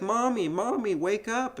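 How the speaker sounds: high-pitched imitation of an eager child; little kid waking mom. Host as boy waking his mother in bed, high childlike pleading. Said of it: "to his mother, who's lying in bed. And he's like".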